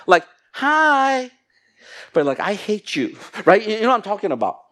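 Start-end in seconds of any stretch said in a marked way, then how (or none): 0.5-1.3 high, loud, drawn-out phony hello; fake-friendly greeting. Preacher acting a churchgoer hiding dislike, fake-friendly greeting. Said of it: "things externally, but does not match up with". 2.4-3.2 mock hateful inner voice; hidden dislike. Preacher voicing the thought behind the friendly hi, mock hateful. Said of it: "what you're feeling internally".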